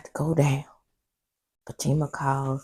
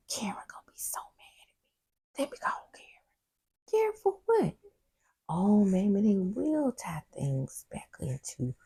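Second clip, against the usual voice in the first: soft unvoiced fretting; whispered aside; 3 semitones higher, 3 dB quieter than usual. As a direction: whisper it as if hiding from Karen.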